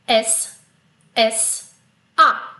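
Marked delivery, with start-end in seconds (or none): none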